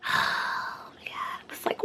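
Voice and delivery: slow, worn-out whisper; whispered oh my God. Real, not acted. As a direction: breathe it out, almost crying. Exhausted on the slow bus.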